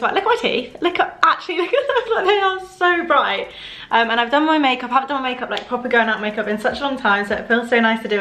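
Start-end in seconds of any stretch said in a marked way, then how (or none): 0.1-3.5 high, gushing and bright; excited. Real, not acted. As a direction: show off with delight. Showing off whitened teeth.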